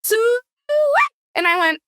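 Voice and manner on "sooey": high, drawn-out "sooey" call; long high hog-calling cry; pig calling. Retold or imitated. Host imitating a friend's pig calling, high call. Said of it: "And then she went".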